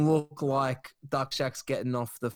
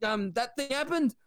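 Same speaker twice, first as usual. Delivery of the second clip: much higher, flustered denial; panicked Ricky; 8 semitones higher than usual. Retold or imitated. Ricky denying the scandal to Wayne, high and panicky. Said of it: "Ricky's like".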